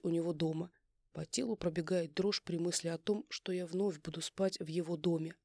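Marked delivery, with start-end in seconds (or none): none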